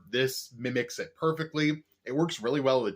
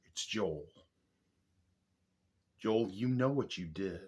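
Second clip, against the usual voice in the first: ominous low; 4 semitones lower, 6 dB quieter than usual. Acted. Mock grudge at Joel.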